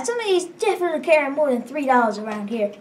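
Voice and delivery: ordinary delivery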